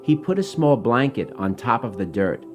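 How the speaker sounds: plain reading voice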